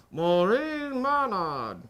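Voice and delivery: slow, jaw shut, imitating gritted teeth; mock her talking through teeth. Shown as a demonstration. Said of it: "It sounds like you're going like this".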